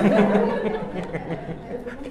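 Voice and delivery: high-pitched giggle; amused giggle; high laugh. Real, not acted. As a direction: laugh at your own punchline. Laughs at own punchline.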